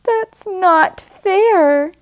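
high, slow, lightly hurt plea; a hint of a disappointed little goat; sad goat. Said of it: "Goat says sadly".

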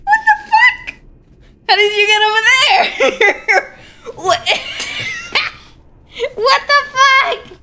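high squeal through laughter; laughing disbelief. Real, not acted. Gamer asks how Freddy got there, high helpless laughing. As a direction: crack up while trying to get the words out. Cracking up at game glitch.

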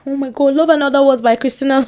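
ordinary delivery

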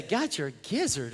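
lightly mocked, low and soft; puzzled listener. Preacher voicing a baffled congregation member, puzzled. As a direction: quietly echo an odd phrase in disbelief.

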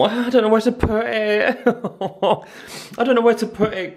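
laughing fluster. Real, not acted. Not knowing where the plant goes, high laughing fluster. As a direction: laugh it out, at a loss.

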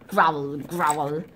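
low spoken growl; mock growl. Playing the wolf for the kids, a low spoken growl.

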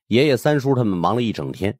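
ordinary delivery, nothing marked

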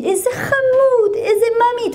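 very high, drawn-out cooing; sugary high-pitched singsong praise; gushing nursery teacher. Retold or imitated. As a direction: imitate a teacher gushing over a little darling. Nursery teacher gushes over the child.

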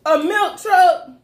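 high, loud burst of triumph; excited shout. Real, not acted. Player to quizmaster on cracking the riddle, excited shouting. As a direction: whoop out the answer. Family shouts out the guessed answer.

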